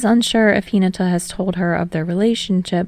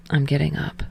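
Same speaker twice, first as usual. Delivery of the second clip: Tsukishima voiced low; 4 semitones lower, 6 dB quieter than usual. Tsukishima's voice, lower.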